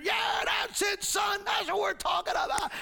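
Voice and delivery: high, jubilant crowd yell; cheering fans. Acted. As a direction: cheer wildly like a proud dad. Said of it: "Fans are cheering".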